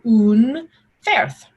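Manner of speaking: Unferth with U like poo, OON-fairth; Old English pronunciation. Shown as a demonstration. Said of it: "It's U like poo".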